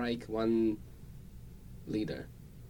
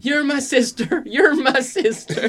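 high, slow, loud repeated line; hammed-up high whiny repeat; mock movie quote; 9 dB louder, slower than usual. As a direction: wail it like the shocked character. Quoting Joe Dirt line.

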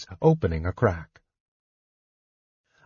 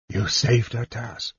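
softer, catching on the name; big man choking back grief; 3 dB quieter than usual. A narrator's reading. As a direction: voice choked with tears.

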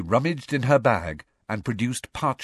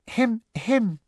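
high, prim little throat-clearing; Professor Umbridge. A narrator's reading. Student mimicking a teacher to stop a quarrel, prim. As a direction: interrupt with a high affected cough-word. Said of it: "such a good imitation of Professor Umbridge".

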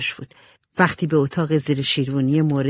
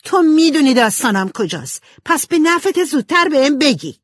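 high, loud furious demand; 11 semitones higher, 6 dB louder than usual. A narrator's reading. Jo shakes Amy demanding the story, furious and loud. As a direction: threaten her hard.

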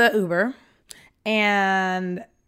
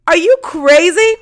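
loud, high, drawn-out disbelief; incredulous outburst; 8 semitones higher, 9 dB louder than usual. Retold or imitated. Woman retelling her reaction to being sent downstairs, incredulous. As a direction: exclaim it in mock outrage, stretching it out.

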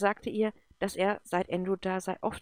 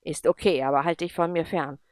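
louder, hard and cold; curt rejection; 7 dB louder than usual. Acted. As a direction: brush him off firmly. Akam rejects the apology. (laut und abweisend)

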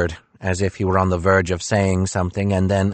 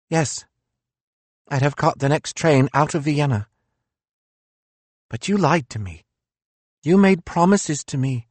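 higher, bitter philosopher voice; reproachful, raised-pitch Nietzsche; 8 semitones higher than usual. Acted.

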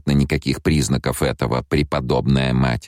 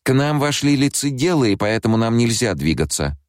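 higher urgent messenger voice; 10 semitones higher than usual. A narrator's reading. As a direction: say it high and hurried. Messenger's touch message.

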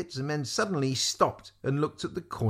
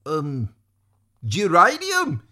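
high, loud flustered squire; blurting a password; 6 semitones higher, 8 dB louder, slower than usual. A narrator's reading. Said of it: "said the squire".

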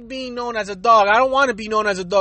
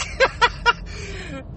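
high giggle; 8 semitones higher, 5 dB quieter than usual. Real, not acted. Laughs at the dumb excuse.

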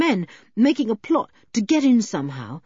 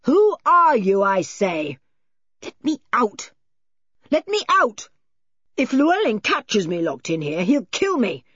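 high, urgent calling out; agitated man calling through a locked door; frantic demands; 6 semitones higher, 5 dB louder than usual. Acted. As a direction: demand release in mounting panic.

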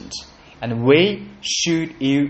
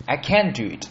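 ordinary talk, nothing marked

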